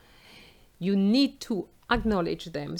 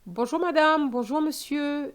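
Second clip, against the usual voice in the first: much higher and louder, cheerful phone greeting; a polite caller's eager hello; bright formal greeting; 7 semitones higher, 4 dB louder than usual. Shown as a demonstration. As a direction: open the call up high and bright. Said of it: "here's an example of an introduction".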